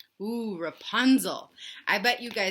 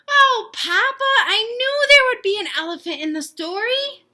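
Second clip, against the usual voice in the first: chicken voice; 13 semitones higher, 11 dB louder than usual. Acted. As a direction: gush like a thrilled little chicken. Chicken delights.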